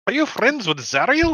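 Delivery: put-on high, loud Indian accent. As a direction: press the question in an Indian accent. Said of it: "he's talks in an Indian voice".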